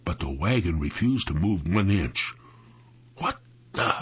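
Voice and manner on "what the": voiceless, cut-short exclamation; startled exclamation. A narrator's reading. Sam finding the wagon stuck, exclaiming. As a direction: let it out under the breath, startled. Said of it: "Sam exclaimed".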